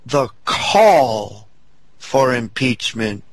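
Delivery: "call" held long and leaned on; slow down and weigh the word "call"; stress on call. Real, not acted. Host insisting to the audience on the call, stress on "call". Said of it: "we emphasize just what you did just now".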